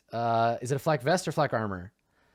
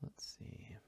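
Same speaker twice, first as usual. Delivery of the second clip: unvoiced whisper to self; 3 semitones lower, 24 dB quieter than usual. Real, not acted. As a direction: whisper it to yourself. Muttering while searching.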